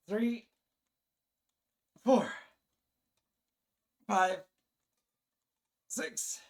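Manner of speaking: strained counting, breaking into laughs; grunting out each rep; straining counting. Real, not acted. Straining through push-up reps, laughing.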